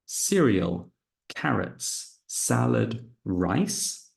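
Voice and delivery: carrots with a hard k, cereal, salad, rice with s; careful word list. Shown as a demonstration. Teacher reading words for students. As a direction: read each word clearly. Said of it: "Listen and circle what is different".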